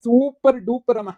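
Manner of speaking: slow, loud, high super duper; mock praise. Real, not acted. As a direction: cheer her acting like a fan. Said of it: "ಏನ್ ನಾಟಕ ಮಾಡ್ತೀಯಾ?".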